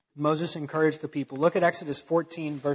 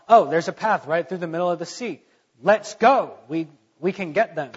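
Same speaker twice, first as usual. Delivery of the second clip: hammed-up army rushing in; mock eager Egyptians; 7 dB louder than usual. Proud Egyptian soldiers spotting the path, mock eager shout. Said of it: "they saw".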